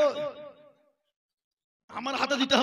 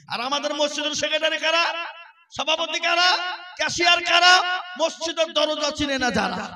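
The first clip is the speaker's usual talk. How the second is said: questions climbing higher and louder; building rhetorical challenge; 4 semitones higher, 8 dB louder than usual. Real, not acted. Preacher challenging who runs the mosque, rising to a shout.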